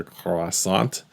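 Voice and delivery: normal talking voice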